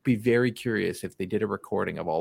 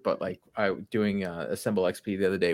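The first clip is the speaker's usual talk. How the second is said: usual speaking voice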